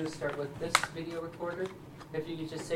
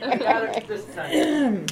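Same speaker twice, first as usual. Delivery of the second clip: slow, much higher and louder with laughter; laughing; 11 semitones higher, 14 dB louder, slower than usual. Real, not acted. Cameraman laughing, reassuring the elders he's recording. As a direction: laugh while promising it's recording.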